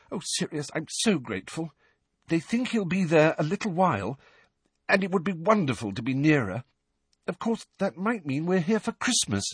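a relieved mother thanking him; lightly voiced grateful mother. A narrator's reading. Mrs Weasley voiced in narration.

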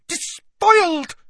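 very high, loud, slow accusation; scandalised high-pitched librarian; hissed outrage. A narrator's reading. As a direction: hiss it out loud and high, stretched. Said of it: "she hissed".

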